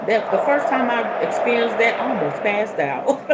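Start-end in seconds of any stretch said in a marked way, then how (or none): none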